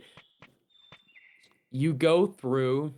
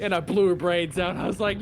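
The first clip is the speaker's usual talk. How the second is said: usual speaking voice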